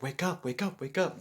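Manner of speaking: urgent inner wake-up call; urgent self-call. Retold or imitated. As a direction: plead with yourself to wake. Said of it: "you're like".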